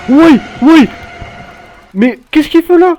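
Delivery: high startled cry; frightened high shout; startled scream. Real, not acted. Scared by killer in toilets.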